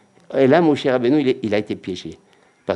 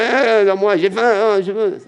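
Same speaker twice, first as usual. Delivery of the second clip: put-on high, loud whiny child demand; be a toddler who wants it now; mock child crying out eh eh eh for food; 11 semitones higher, 5 dB louder than usual. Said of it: "il vient avec sa puissance".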